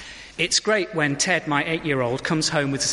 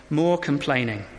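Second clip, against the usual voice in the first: ordinary talk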